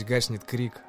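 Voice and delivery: usual speaking voice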